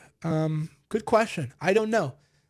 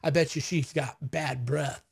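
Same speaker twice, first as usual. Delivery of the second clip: sour dismissive man; 2 semitones lower than usual. Retold or imitated. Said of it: "a man goes".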